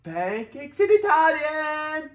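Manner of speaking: Pancakes in Italian high, slow; excited high singsong. Shown as a demonstration. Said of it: "repeat after child".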